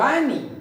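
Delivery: Rainy with a long, full RAI and short falling ny; stress on first syllable. Shown as a demonstration. Teacher modelling rainy, rainy with strong first syllable. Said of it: "रैनी नहीं".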